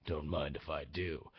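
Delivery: deep, hushed trailer-guy reply; movie trailer voice. Acted. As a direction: drop it low like a movie trailer hero. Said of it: "That's how I'm doing my trailer impression".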